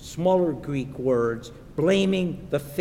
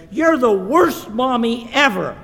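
high, loud acted child's outburst; stomping child's tantrum; 7 semitones higher, 8 dB louder than usual. Olivia stomps out on her mom, acted tantrum yell. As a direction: hurl it like a sulky six-year-old. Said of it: "she goes on stomping out of the kitchen".

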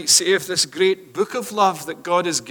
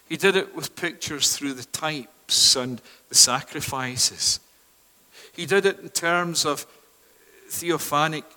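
usual speaking voice

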